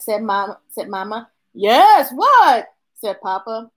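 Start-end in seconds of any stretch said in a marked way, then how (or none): 1.5-2.7 calling back. Acted. Papa answers a call.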